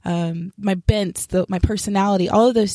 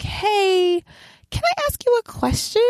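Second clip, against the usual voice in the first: God's gentle voice; 13 semitones higher than usual. Retold or imitated. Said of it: "God was kinda like".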